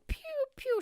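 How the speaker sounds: very high cartoon pew pew; high sound effects for someone rushing by; zippy pew sounds. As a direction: zip past like a laser. Imitating waitress zipping past.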